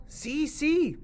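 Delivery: high, loud excited agreement; eager yes. Acted. Nieto eagerly agreeing to talk with animals. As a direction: jump at the chance.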